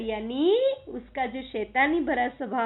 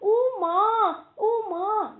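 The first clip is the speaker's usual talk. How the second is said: drawn-out mock crying call, slow and higher; mock wailing; 8 semitones higher, much slower than usual. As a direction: wail for mother in a hammed-up cry. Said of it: "Mohan जोर जोर से बोला". (नाटकीय रोते हुए माँ को पुकारना)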